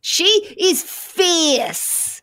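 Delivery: drawn-out, high, sassy diva voice; sassy fierce. Pretend. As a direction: stretch every word like a fierce diva. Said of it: "she sounds fierce".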